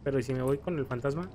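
ordinary talk

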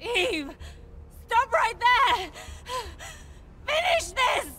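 desperate shout. Acted. Desperate shouting after fleeing Eve.

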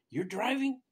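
high, loud retold disbelief; upset doctor. Doctor learning the heart patient drove himself, upset. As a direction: imitate a doctor appalled at the answer. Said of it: "she's all upset".

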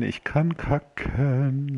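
drawn-out and teasing, slow; slow singsong. Shown as a demonstration. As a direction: stretch each word out slowly. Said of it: "Guck mal".

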